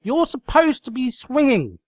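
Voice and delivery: loud, high frantic cry; loud shrieking demand; cried out. Acted. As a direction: yell it like a crazed drill sergeant. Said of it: "she cried".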